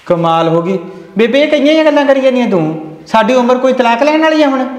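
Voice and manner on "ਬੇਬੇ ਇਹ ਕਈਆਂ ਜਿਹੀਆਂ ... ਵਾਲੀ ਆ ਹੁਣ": exasperated disbelief. Acted. Son protests mother's divorce demand.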